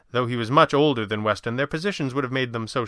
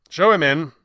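louder, firm order to show the visitor in; firm summons; 5 semitones higher, 9 dB louder than usual. Acted. Master of the house firmly ordering his clerk, firm summons. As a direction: call it out like a master to his servant.

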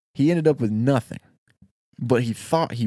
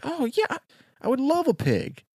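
much higher, delighted simpleton voice; gullible eager worker; 13 semitones higher than usual. Acted. Storyteller voicing the worker delighted to get a pig, high and eager. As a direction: jump at the offer like a happy fool.

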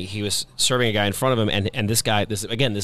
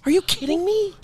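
thrilled disbelief. Real, not acted. Thrilled disbelief at being remembered.

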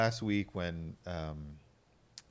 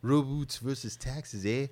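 mock Canadian accent; 6 semitones higher, 4 dB louder, slower than usual. Riffing on Canadian jokes.

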